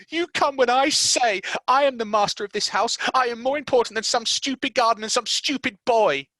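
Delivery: high, loud imperious yell; young master bossing Mary, loud and high; spoilt boy shouting. Acted. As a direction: order her about like a little tyrant. Colin tantrum.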